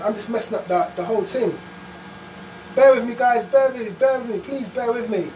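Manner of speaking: ordinary delivery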